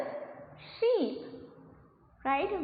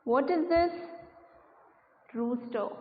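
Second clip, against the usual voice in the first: normal talking voice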